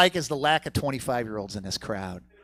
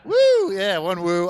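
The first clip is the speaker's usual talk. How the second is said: ordinary talk, nothing marked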